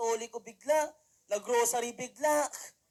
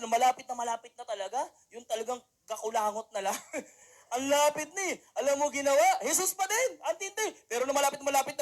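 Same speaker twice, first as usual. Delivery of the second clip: ordinary talk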